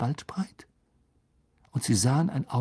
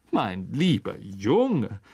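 slow, drawn-out patronising address; oily, stretched condescension; condescending; 3 semitones lower, much slower than usual. A narrator's reading. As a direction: talk down to a naive boy. Lockhart condescends to Harry.

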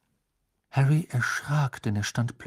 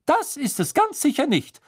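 high, louder indignant retort; indignant old witch; 6 dB louder than usual. Acted. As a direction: bristle like an offended little witch. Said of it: "sagte die Hutzelhexe entrüstet".

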